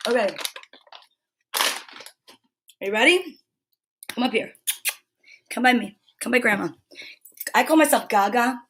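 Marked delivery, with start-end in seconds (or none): none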